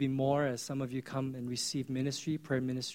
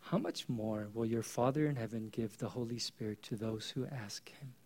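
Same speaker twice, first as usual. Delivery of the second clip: hushed scripture; 6 semitones lower, 8 dB quieter than usual. Acted. Preacher quoting Jesus softly.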